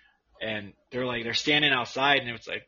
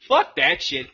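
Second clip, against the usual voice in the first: ordinary talk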